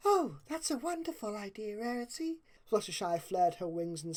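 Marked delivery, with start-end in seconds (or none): 0.1-2.4 softer, high and gentle; Fluttershy's voice. A narrator's reading. Fluttershy softly praising Rarity's plan. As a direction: speak it timidly, eager to please.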